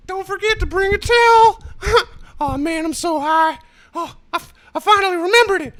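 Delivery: very high, loud cartoon squeal; South Park voice. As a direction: squeak it like a stoned cartoon character. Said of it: "Do the South Park voice".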